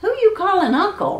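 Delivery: high-pitched indignant challenge, only mildly roared; roaring giant. Acted. Giant objects to being called uncle, mildly roaring. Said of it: "roared the giant".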